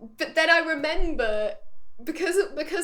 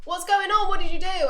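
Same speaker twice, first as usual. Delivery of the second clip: high, raised-voice alarmed demand; Saeed; 6 semitones higher, 6 dB louder than usual. Retold or imitated. Saeed outside the blast doors, urgently demanding answers. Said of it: "Saeed's on the outside".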